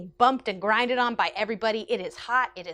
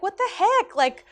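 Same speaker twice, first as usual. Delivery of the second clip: shocked protest; 8 semitones higher, 7 dB louder than usual. Retold or imitated. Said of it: "and I was like".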